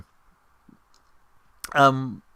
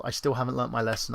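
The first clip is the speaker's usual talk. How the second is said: normal talking voice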